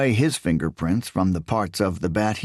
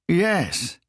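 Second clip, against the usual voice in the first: a much higher, proud yes, me; 9 semitones higher than usual. A narrator's reading. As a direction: boast it, chest out. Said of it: "he said proudly".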